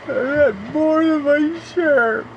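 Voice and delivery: high, slow, cracking on the verge of tears; a strained, tearful crack running through the whole line; voice breaking. Real, not acted. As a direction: push the words out through rising tears. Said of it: "I'm not crying".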